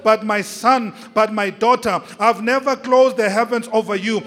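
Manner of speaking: loud, forceful preacher voicing God; full-force preaching. Preacher speaks as God to a struggling son and daughter, full-force preaching. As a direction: thunder it out phrase by phrase.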